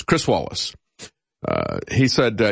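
usual speaking voice

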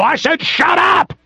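high, drawn-out mock yell; imitated screaming cop. Cop cutting off whoever talks back, mock yell. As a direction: act out a cop yelling over someone. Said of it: "And you talk, and they go".

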